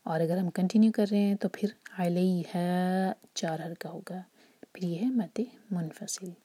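usual speaking voice

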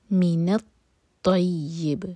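slow recitation of من الطیب, the ط full-mouthed and heavy; heavy taa. Shown as a demonstration. Teacher recites slowly so the student hears the heavy ط. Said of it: "تو پر شدہ اور تو heavy ہوگا".

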